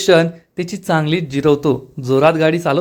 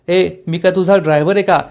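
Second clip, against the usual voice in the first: plain reading voice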